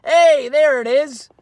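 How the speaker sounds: high, loud and slow, drawn out; excited relief. Acted. Dad spotting the lost car, high loud relieved cry.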